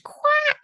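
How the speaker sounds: piercingly high, loud duck quack; enraged quack. A narrator's reading. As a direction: quack in outrage. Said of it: "she cried".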